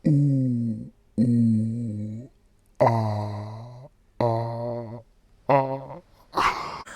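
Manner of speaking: deep, slowed-down toy monkey hoots; toy monkey noise. Retold or imitated. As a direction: make slow, low monkey toy sounds. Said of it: "you hear".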